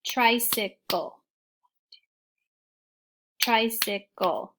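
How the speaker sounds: slow tri-cy-cle in three beats; clapped syllables. Shown as a demonstration. Teacher clapping tricycle slowly for kids. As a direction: stretch Tricycle into three claps. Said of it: "Let's do it again".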